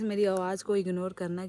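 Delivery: normal talking voice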